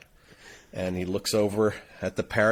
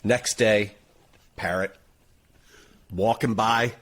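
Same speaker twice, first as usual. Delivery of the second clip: ordinary talk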